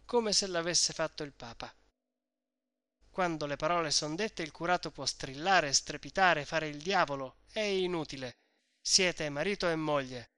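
ordinary delivery, nothing marked

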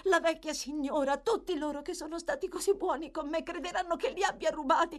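high-pitched, desperate plea; pleading in passionate distress; impassioned suffering. A narrator's reading. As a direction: beg for mercy with anguished fervour. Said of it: "pronunciate con tutta la foga di un'appassionata sofferenza".

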